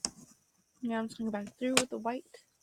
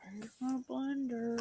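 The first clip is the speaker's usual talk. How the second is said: slow exasperated ask; 3 semitones higher, slower than usual. Real, not acted. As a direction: stretch it out, exasperated. Repeated slower.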